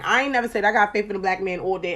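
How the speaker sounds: normal talking voice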